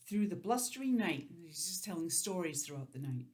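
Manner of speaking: ordinary delivery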